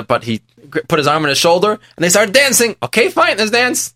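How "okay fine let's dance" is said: high, bright "okay, fine" with a leap up; eager agreement. Retold or imitated. Rich man happily giving in, high-pitched and eager. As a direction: jump in happily, pitch soaring.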